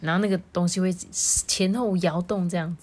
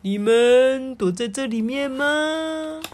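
slow, high, stretched-out question; playful seeker; 7 semitones higher, 4 dB louder, slower than usual. A narrator's reading. Doctor at an open gate, singsong searching question. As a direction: coax hiders out in singsong.

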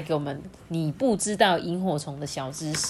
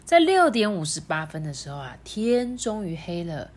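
put-on reading to a toddler; 3 semitones higher than usual. Story narration resumes.

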